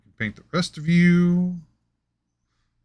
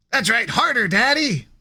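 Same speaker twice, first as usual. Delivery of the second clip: high mock villain; 9 semitones higher than usual. Skeletor parody line.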